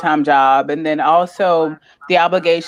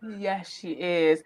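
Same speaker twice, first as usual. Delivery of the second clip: soft agreement; 11 dB quieter than usual. Real, not acted. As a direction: quietly savour each word. Agreeing fondly about a friend.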